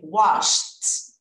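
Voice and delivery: "Washed" said wósht, one syllable ending in T; T sound added. Shown as a demonstration. Teacher drilling the past of wash for the class, crisp T. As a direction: model "Washed" with a short T after the SH. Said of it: "eu vou acrescentar o T".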